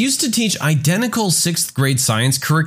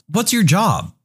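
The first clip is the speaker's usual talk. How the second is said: put-on higher-pitched kid voice; lightly voiced pupil asking; curious student; 4 semitones higher than usual. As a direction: ask it pitched up, like a schoolkid. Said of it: "Another student asked".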